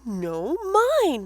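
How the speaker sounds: high, loud whine; whining flea. Acted. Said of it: "whined the flea".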